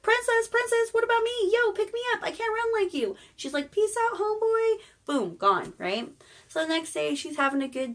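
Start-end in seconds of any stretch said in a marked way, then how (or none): 0.0-3.2 high, loud pleading frog voice; frog calling out. Retold or imitated. Frog calls after fleeing princess. 3.7-4.8 high, loud kiss-off; breezy mock farewell. Princess calls back while running off.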